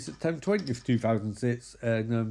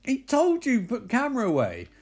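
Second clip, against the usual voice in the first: high-pitched mock-furious scolding; 10 semitones higher than usual. Girlfriend scolds boyfriend for still filming, mock furious. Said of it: "she's furious".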